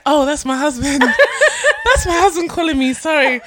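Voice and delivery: cracking up while imitating her; laughing retelling. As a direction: barely get the quote out for laughing. Quoting the lady while laughing.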